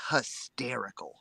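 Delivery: whispered. Real, not acted. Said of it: "whispered word".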